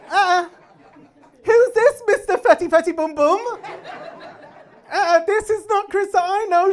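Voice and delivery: high, loud, sassy refusal; mocking phone voice. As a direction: play an outraged phone refusing its owner. Acts iPhone rejecting his face.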